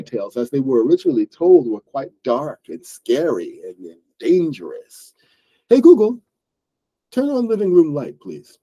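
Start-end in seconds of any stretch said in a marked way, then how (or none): 5.7-8.6 voice command. Real, not acted. Speaking a command to smart speaker.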